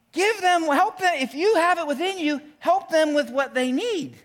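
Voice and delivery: high, loud impassioned plea; pleading call. Retold or imitated. Apostle urging believers, loud and pleading. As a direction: cry out to help them. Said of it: "He's saying".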